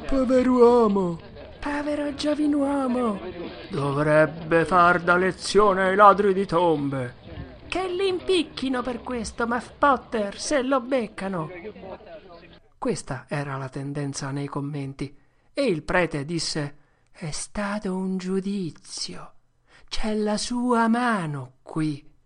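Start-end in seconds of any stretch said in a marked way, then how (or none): 0.1-11.5 loud, high indignant townsfolk; shocked villagers exclaim over the body; crowd outrage. Acted. Townsfolk shocked at the murder, loud and high. 17.1-22.1 slow, grave pronouncement; solemn preacher. Acted. Preacher speaking to the crowd, slow and solemn.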